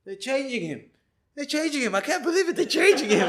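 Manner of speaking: high, excited disbelieving cries; amazed onlooker. Retold or imitated. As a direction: imitate a man running about amazed. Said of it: "running up and he goes".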